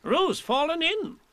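high, urgent cry; alarmed cry. Acted. Rabbit crying out that Roo fell, high and urgent. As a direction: shout the alarm. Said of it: "cried Rabbit".

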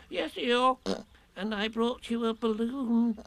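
high, thin small-animal voice; Piglet. A narrator's reading. Piglet tells Eeyore of his present, high and piping. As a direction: pipe the news up high.

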